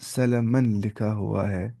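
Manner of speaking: drawn out for writing along; unhurried, stretched words; slow dictation. Shown as a demonstration. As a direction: dictate slowly at low pitch.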